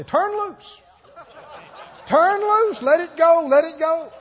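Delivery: high, loud mock altar coach; shouted urging. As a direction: holler it high, insisting he give in. Acts people urging him in front.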